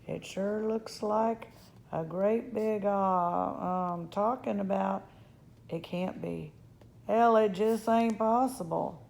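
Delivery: disbelieving cowboy. Acted. Cowboy seeing himself in cupped hands, disbelieving. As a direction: puzzle it out, then burst into disbelief.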